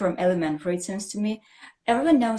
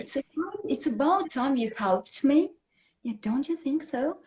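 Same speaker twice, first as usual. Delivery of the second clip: high-pitched sarcastic dig; sarcastic reproach; 7 semitones higher than usual. Acted. Teacher showing how to reproach someone, sarcastic and mocking. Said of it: "I want to be sarcastic".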